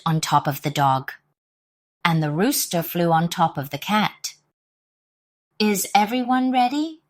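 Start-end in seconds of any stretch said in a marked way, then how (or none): none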